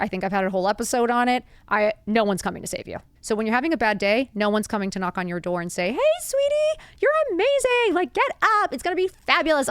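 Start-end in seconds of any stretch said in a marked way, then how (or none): 5.9-9.7 very high, sugary sing-song; hammed-up cheery fairy-godmother coo; mock gushing rescuer. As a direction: gush in a put-on squeal, easing down. Imagined rescuer knocking on the door.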